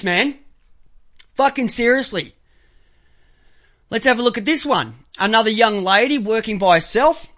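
ordinary talk, nothing marked

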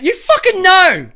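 exasperated, drawn-out shout; angry accusation. Real, not acted. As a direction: drive each word home, loud and high. Accuses Drew directly.

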